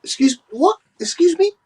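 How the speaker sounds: high, incredulous "excuse me"; shocked and offended; outraged disbelief. Real, not acted. Outraged at the quoted statement.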